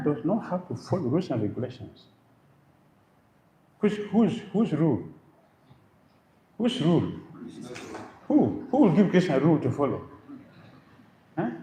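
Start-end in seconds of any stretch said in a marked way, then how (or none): 3.8-11.5 higher, faster, pressing questions; rhetorical challenge. Real, not acted. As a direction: challenge the crowd, who could?.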